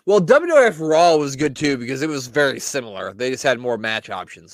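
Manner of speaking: ordinary talk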